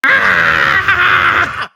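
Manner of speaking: long, loud, high scream. Acted. Boasting cowboy suddenly in trouble, loud scream. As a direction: break into a full-voiced yell.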